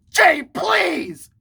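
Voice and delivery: high, loud imitation of losing it; mock outraged shout. Imitating Angel scolding the speaker, mock outraged shout. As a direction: shout it like someone losing it, hammed-up. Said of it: "Angel lost his fucking shit".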